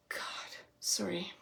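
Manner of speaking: whispered, sighing apology; holding back tears. Real, not acted. Medium apologising to the client, whispering to keep from crying. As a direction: sigh and barely voice it, fighting tears. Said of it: "I'm not gonna cry".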